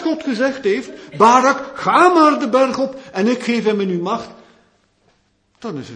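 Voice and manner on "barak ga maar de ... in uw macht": loud, high call of God to Barak; booming divine command. Acted. Preacher booms God's order to Barak, loud and high. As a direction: proclaim it like the voice of God.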